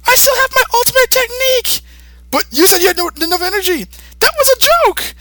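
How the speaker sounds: put-on whiny kid voice. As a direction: whine like a bratty kid. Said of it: "Gotenks says".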